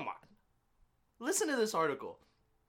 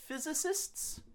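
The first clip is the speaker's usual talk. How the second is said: questioning high; slower than usual. Shown as a demonstration. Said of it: "just say it real quick".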